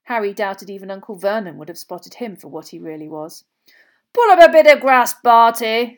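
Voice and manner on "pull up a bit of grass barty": loud, high, hearty invitation; bright. A narrator's reading. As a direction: call it out brightly to an old colleague. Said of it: "said Ludo brightly".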